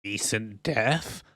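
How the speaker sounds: very slow, hushed crone; put-on spooky hag voice; creepy woman. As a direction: echo it softly as an eerie question. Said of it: "And she goes".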